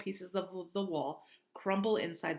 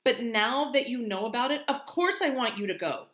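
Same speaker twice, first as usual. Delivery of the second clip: angry; 6 semitones higher, 9 dB louder than usual. Acted. Via arguing with Mom.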